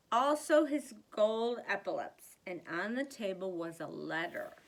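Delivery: ordinary delivery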